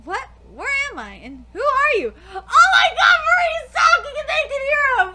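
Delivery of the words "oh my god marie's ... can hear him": high, loud laughing shout; shocked shriek. Real, not acted. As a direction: freak out in disbelief. Shocked reaction to the scene.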